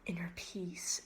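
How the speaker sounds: breathy whisper of Inner peace. Retold or imitated. Kid quoting the movie's mystic line, whispered. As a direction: hush it like a secret mantra. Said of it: "when they're like".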